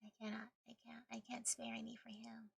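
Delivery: usual speaking voice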